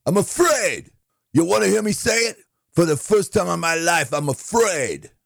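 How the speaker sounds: very high, strained cry; hammed-up catchphrase replay. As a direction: act out the fearful confession way up high. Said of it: "Let's play it back for you".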